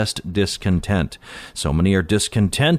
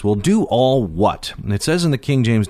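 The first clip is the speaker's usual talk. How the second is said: usual speaking voice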